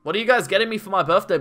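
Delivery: ordinary talk, nothing marked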